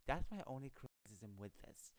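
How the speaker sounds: normal talking voice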